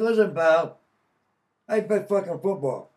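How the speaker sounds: loud, high, defiant comeback; defiant retort. Retold or imitated. To the probation officer, a loud defiant retort. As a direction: puts the officer in his place.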